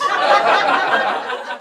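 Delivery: loud unvoiced burst of laughter; helpless wheezing laugh. Real, not acted. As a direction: break up laughing at the answer. Bursts out at hundred feet.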